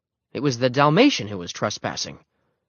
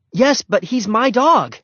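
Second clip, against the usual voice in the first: loud, high, slow protest; pleading explanation; 12 semitones higher, 6 dB louder, slower than usual. A narrator's reading. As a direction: plead your case to the man. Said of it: "Henry tried to explain".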